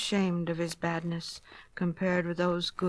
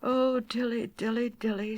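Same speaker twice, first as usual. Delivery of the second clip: sigh; 4 semitones higher than usual. Acted. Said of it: "sighed Simpkin".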